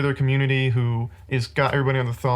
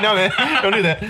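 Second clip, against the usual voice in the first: louder, higher, through laughter; laughing mock objection; 6 semitones higher, 4 dB louder than usual. Host acts out the book's society rejecting slang, laughing. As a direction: crack up while voicing the objection. Acting out reply while laughing.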